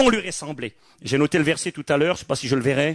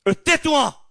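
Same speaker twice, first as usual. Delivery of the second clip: crowd hushing; 10 semitones higher, slower than usual. Retold or imitated. Crowd silencing the beggar Bartimée, high scolding hush. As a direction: shut the beggar up. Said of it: "vont lui dire".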